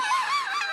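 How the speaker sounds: sharp high gasp of delight. Retold or imitated. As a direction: gasp like a thrilled child. Child gasping at return.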